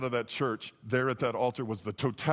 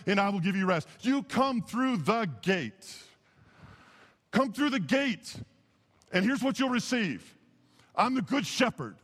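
high-pitched, emphatic sermon delivery; driving pulpit declaration; impassioned preaching; 8 semitones higher than usual. Real, not acted. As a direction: proclaim each claim like a preacher in full flow. Preaches at full force.

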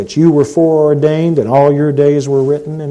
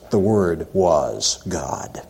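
whispered, slow and much softer; hushed whisper; 9 semitones lower, 9 dB quieter, much slower than usual. Shown as a demonstration. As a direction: breathe the correct translation in a reverent whisper. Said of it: "The proper translation is".

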